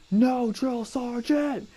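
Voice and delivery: high, slow, small recruit voice; meek recruit reply. Retold or imitated. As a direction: answer like a cowed private. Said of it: "I go".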